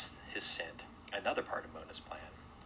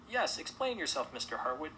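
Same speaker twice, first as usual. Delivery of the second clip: high-pitched lady's demand; 7 semitones higher than usual. A narrator's reading. Lady Sudsbury pressing the host to explain, high demand. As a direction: insist on an answer, pitched up high. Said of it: "demanded Lady Sudsbury".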